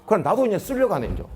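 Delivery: ordinary delivery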